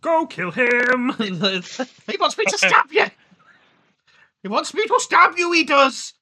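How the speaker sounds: high, slow sinister sing-song; mock Welsh villain. Imaginary advert man urging a stabbing, high and slow. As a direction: goad him on in a menacing lilt. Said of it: "And he's like going".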